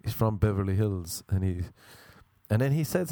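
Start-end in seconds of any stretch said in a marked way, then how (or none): none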